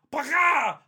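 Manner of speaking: loud high mock gunshot, shouted; gunshot noise. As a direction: bark out a gun blast sound. Imitating the gunshot.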